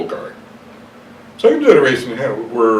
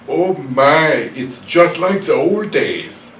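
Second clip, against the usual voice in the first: lightly put-on thick European accent, slow and raised; a hint of an old European woman, startled; 6 semitones higher, 4 dB louder, slower than usual. Startled old woman to the man who bumped her, mild European accent. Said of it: "in a thick accent, European accent".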